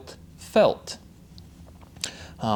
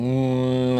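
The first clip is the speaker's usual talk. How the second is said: humming. Shown as a demonstration. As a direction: hold a resonant mm.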